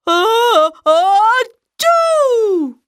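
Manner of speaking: ah-ah-choo rising high; building sneeze. Acted. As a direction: let the sneeze build then burst.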